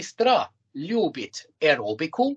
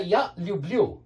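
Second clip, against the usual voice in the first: Я люблю with the stress on the last syllable, лю-БЛЮ; stress on the end. Shown as a demonstration. Teacher showing a Russian class the stress in люблю. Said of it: "the stress is on the end".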